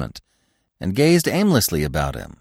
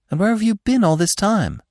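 much higher, amazed question; astonished; 11 semitones higher than usual. A narrator's reading. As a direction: ask in wide-eyed wonder. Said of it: "asked Feline in astonishment".

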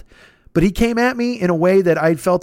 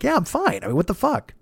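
incredulous reassurance; 5 semitones higher than usual. Retold or imitated. Friends ask if he's okay.

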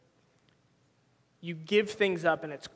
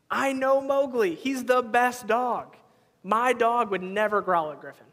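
confident insistence; 4 semitones higher, 4 dB louder than usual. Retold or imitated. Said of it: "And I said".

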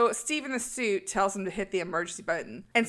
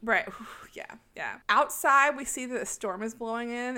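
ordinary delivery

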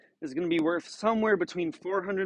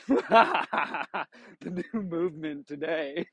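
laughing through the words, slow; giggling while saying it; much slower than usual. Real, not acted.

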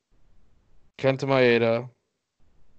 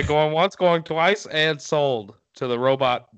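higher-pitched mock auctioneer closing a sale; 3 semitones higher than usual. Host teased as a robot sells to the robot buyer, auctioneer voice. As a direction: call the final bids like an auctioneer. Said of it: "talk like an auction-, auctioneer".

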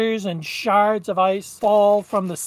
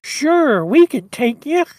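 higher nasal reindeer whine; obliging reindeer, high and nasal; whiny, nasally Rudolph; 4 semitones higher, 4 dB louder than usual. Acted. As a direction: agree in a whiny cartoon voice. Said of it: "he's got that kinda really whiny, nasally voice".

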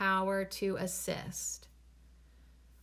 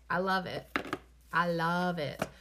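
normal talking voice

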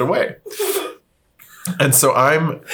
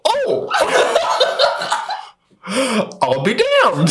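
high, laughing I'll be damned; laughing surprise; 10 semitones higher, slower than usual. Retold or imitated. Telling a friend, laughing at the lost cotton. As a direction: gasp it out amused.

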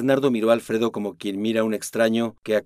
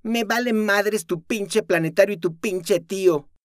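furious; 10 semitones higher than usual. Acted. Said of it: "gritó la joven".

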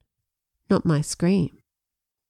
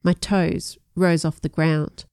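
plain reading voice

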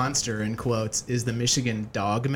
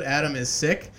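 normal talking voice

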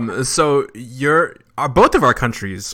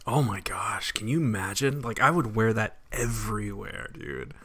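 whisper; 6 dB quieter than usual. Real, not acted. Dreaming of wearing Flair's robe.

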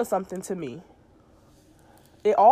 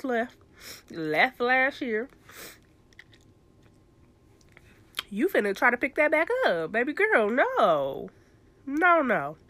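sassy self-scolding; 4 semitones higher than usual. Retold or imitated.

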